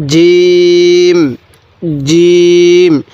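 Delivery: জিম with a hard j and very long vowel; teacher's firm, drawn-out demo of জিম; firm articulation. Shown as a demonstration. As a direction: hit the j firmly and stretch জিম into a hum. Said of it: "একটু শক্ত করে উচ্চারণ করব".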